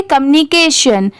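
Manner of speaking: Communication said quickly as one word; quick fluent reading; at speed. Shown as a demonstration. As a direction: run communication together at natural pace. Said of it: "इसे speed में पढ़ेंगे".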